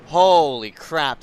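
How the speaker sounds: slow, loud drawn-out holy crap; stunned disbelief. Real, not acted. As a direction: draw it out in disbelief. After the wreck.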